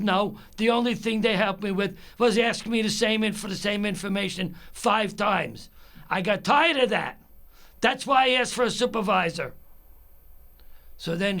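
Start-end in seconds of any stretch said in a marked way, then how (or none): 0.0-9.5 higher, louder heated retort; exasperated. Retold or imitated. Caller complaining to the supervisor about repeated questions, exasperated. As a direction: pile up the grievance, rising in pitch and force.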